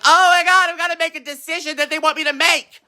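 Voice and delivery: high, strained mocking voice of a confused voter. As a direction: whine it up high like a helpless voter. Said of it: "And you'd be like".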